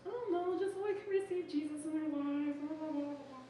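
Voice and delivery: high, babbling engaged-youngster voice; mock young fiancée. Imitating the young engaged person answering.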